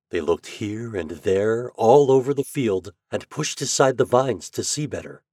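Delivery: plain reading voice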